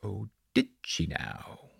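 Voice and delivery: slow, hushed mock surprise; soft, drawn-out disbelief; sarcastic. A narrator's reading. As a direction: draw it out with quiet sarcasm. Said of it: "Gavin replied sarcastically".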